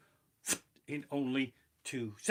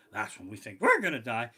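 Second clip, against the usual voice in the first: ordinary talk, nothing marked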